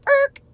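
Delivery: a sudden high braking squeak; high skid noise to stop the momentum; screeching stop sound. Pretend. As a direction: jam on the brakes with your voice.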